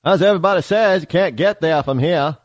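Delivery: put-on light Maine accent bit. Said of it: "I love the Maine accent".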